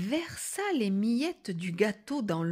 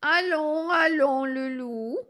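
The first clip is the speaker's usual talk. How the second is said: high granny voice, firm then easing; consoling old grandmother; 7 semitones higher, 6 dB louder, slower than usual. Acted. Grandmother calms the sobbing wolf, consoling granny voice. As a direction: hush someone in an old woman's voice.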